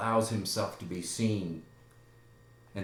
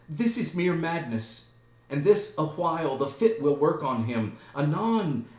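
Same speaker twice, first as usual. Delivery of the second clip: higher, anxious motherly voice; Gertrude; 6 semitones higher, 5 dB louder than usual. Acted. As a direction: explain away your son's fit, pitched up. Gertrude explains her son's madness.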